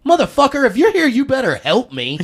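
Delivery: playful yell, loud and high; shouted greeting. Retold or imitated. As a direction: holler it at a buddy you just spotted. Friend yells across stage.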